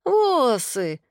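put-on high, childish complaint; small child's indignant whine; indignant little boy. A narrator's reading. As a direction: protest like a toddler with baby pronunciation. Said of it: "возмутился он".

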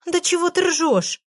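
медленно и гораздо выше, растерянно; растерянно спроси хохочущую подругу. Acted. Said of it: "растерялась я". (растерянно, медленно и высоко)

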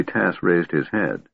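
ordinary delivery, nothing marked

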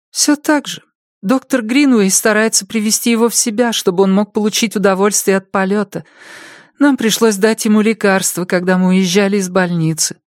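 high, anxious woman's voice; an overwhelmed mother pouring it out; distraught mother. A narrator's reading. (встревоженная мать, высоким голосом)

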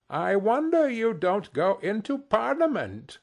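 high-pitched, ironic wonder; mocking. A narrator's reading. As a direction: needle the nephew with mock admiration. Scrooge mocks nephew's speech.

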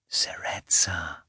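put-on tender whisper into a child's ear; slow, hushed whisper. A narrator's reading. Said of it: "just above the child's ear".

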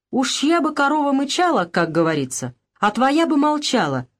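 язвительно, голос повыше; с колкой насмешкой; едко. Acted. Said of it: "едко сказала Анна". (Анна колет мать поговоркой, едко)

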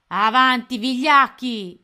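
very loud, slow roared command; rally the cowards with a roar; captain's bellow. A narrator's reading. Pirate captain driving his crew, loud bellow. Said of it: "gridò Capitan Codanera".

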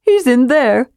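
high, lively kender voice; chirpy and eager. A narrator's reading. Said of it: "said Tass".

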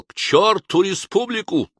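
high, loud rallying shout. Acted. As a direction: whip up the crowd from the tabletop. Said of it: "и кричал".

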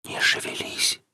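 very soft voiceless whisper; barely audible whisper. A narrator's reading. Unseen speaker warning Alexey to keep still, faint whisper. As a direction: whisper it, hardly to be heard. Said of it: "едва уловимый шёпот".

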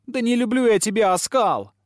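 high, loud cry; exclaiming. A narrator's reading. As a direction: fling it at him. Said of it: "воскликнула Огнянка". (громкое восклицание)

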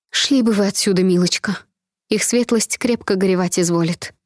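ordinary delivery, nothing marked

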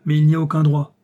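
loud, indignant protest; indignant exclamation. A narrator's reading. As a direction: cry it out in outrage. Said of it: "s'écria le général".

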